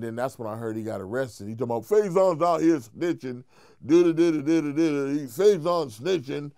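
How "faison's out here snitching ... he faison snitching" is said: mocking the rival. Rival quoted accusing him.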